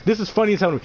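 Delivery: ordinary talk, nothing marked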